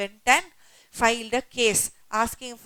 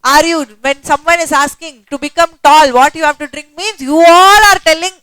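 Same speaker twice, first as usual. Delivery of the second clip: loud, higher-pitched rebuke; exasperated public scolding; 5 semitones higher, 13 dB louder than usual. Acted. Horlicks scolding the public in a service message, loud and exasperated. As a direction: scold them at full volume, acting out the message. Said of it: "Horlicks gave a public service message saying".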